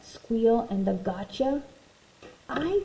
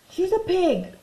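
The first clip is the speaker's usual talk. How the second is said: put-on high, loud kid insisting; a bratty little sister's retort; indignant child voice; 10 semitones higher, 5 dB louder than usual. Child character contradicting adults.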